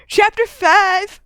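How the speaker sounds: loud, high, drawn-out heading; mock announcement. Podcaster announcing the next chapter for discussion, loud playful shout.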